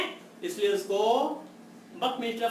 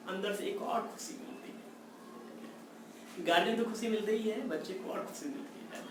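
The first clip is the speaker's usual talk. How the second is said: whisper; 2 semitones lower, 13 dB quieter than usual. Acted. Teacher whispering to students about the inner joy, soft whisper. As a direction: let them in on a secret, whispered. Teacher confiding a happy secret.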